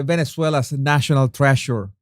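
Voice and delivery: normal talking voice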